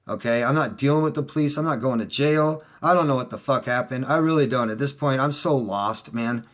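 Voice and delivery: usual speaking voice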